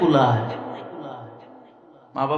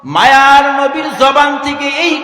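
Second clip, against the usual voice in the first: impassioned chanted preaching, swelling into high, loud cries; melodic sermon storytelling; 4 semitones higher, 11 dB louder than usual. Acted. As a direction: draw the line endings out into ringing wails.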